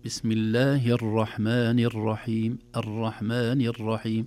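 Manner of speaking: slow model recitation, ر in "الرحمن الرحيم" lightly tapped; balanced light R. Shown as a demonstration. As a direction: recite "الرحمن الرحيم" measured, with a slight trill on ر. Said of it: "في تكرار خفيف".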